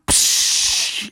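imitated whoosh of water; voiceless "psh" of heads breaking the surface; hushing burst like a splash; splash noise. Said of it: "And they all went".

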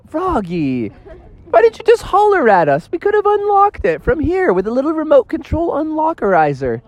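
high, exasperated parent voice; parents' scolding. Acted. Parents scolding Froggy about the alarm, high exasperated. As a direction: chide him for not just calling out. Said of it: "And they're like".

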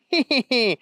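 high, cackling bunny giggle; mocking villain laugh. A narrator's reading. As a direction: laugh high and scornfully at the hero. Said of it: "looked at him and laughed".

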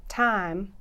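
imitated Southern "Time", vowel flattened toward "Tahm"; Southern accent. Shown as a demonstration. Linguist showing how a Southerner says it, Southern accent. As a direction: say "Time" with a Southern drawn-open "ah". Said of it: "But when a Southerner says".

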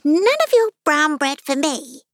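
high, put-on unicorn voice; bossy unicorn. A narrator's reading. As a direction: refuse imperiously in a high voice. Unicorn orders the king about.

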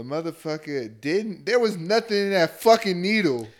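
rising to a shout; outraged shout. Real, not acted. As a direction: yell it in disbelief. Outraged about the fake injection.

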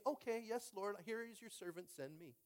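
soft, high, dutiful little voice; mock meek obedience. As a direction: drop to a small, submissive reply. Mock obedient answer to God.

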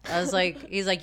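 ordinary talk

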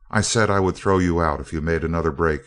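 usual speaking voice

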